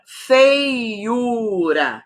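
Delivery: "feiura" drawn out slowly as fei-u-ra; syllable by syllable. Shown as a demonstration. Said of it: "é o ditongo".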